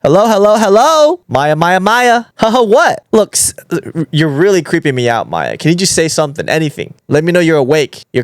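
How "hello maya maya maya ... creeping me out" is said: ordinary delivery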